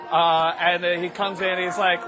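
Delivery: normal talking voice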